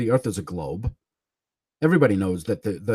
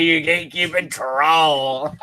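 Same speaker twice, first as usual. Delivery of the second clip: imitating Sam; 3 semitones higher, slower than usual. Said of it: "As Sam would say".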